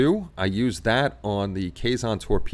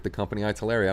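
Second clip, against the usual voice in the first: normal talking voice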